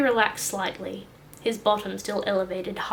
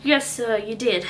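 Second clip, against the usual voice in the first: pained admission; 7 dB louder than usual. Acted. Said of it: "admitted Mickey".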